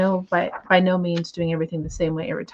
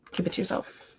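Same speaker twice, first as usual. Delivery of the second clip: whispered aside; 10 dB quieter than usual. Real, not acted. Playful aside to critics.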